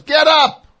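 screaming. Retold or imitated. Wife screaming at the drunk stranger, full scream. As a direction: scream it right in his face. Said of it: "She screams in his face".

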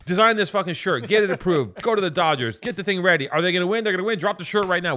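high, loud, rushed self-talk; mock panic voice; frantic inner rush. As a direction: rattle off the frantic to-do list. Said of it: "it's just like".

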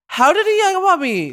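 can't believe he hung up; outraged surprise. Real, not acted. Outraged at hang-up.

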